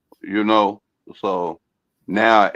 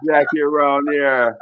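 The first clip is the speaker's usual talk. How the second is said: put-on Jamaican accent; 5 semitones higher than usual. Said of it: "my Jamaican accent".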